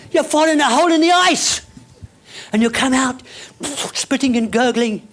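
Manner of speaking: high, loud, fast rising excitement; excited commentary. Pretend. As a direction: sweep them into the thrilling routine. Excited skating fantasy.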